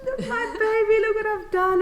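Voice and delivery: put-on new mother crowing over her baby, elated; elated showing off. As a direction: show off the baby to the whole world. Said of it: "You just wanna".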